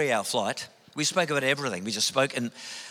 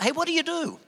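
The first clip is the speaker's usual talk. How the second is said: "Hey, what do you do?" pitched much higher with a big lift on "you"; exaggerated blokey small talk; 12 semitones higher, faster than usual. Man mimicking the typical male opener, hammed-up high query. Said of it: "a man thing that men do".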